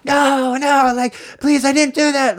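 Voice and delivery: high, frantic begging; pleading scream. Retold or imitated. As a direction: beg for mercy in a panicked high scream. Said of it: "like, screaming".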